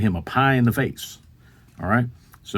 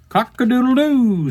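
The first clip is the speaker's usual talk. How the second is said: rooster crow; 14 semitones higher, 4 dB louder than usual. Acted. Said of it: "says the rooster".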